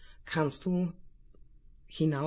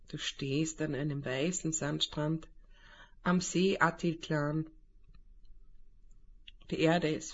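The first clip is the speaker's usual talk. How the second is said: calm guided meditation voice; right in your ear; 3 dB quieter than usual. Acted. Leitet eine Meditation an. (ruhige Meditationsstimme)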